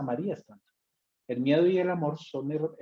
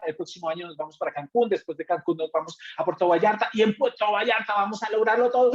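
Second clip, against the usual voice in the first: higher, louder pep-rally pitch; hammed-up motivational speaker; over-energetic hype; 6 semitones higher, 4 dB louder than usual. Shown as a demonstration. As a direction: pump up the room nonstop. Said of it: "todo el tiempo Red Bull".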